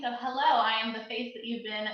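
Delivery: normal talking voice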